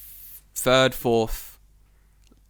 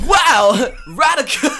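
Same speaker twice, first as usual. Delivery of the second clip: loud, high mock-amazed dude; exaggerated dated slang shout; nineties dude; 13 semitones higher, 12 dB louder, much slower than usual. Mocking an out-of-touch expat, hammed-up dude voice. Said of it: "still speaks English that way".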